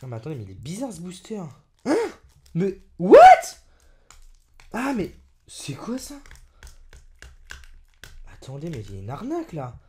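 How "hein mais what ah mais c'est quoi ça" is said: sudden loud what; shocked what. Real, not acted. Youtuber spotting a strange sticker on the booster, shocked. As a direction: blurt it out.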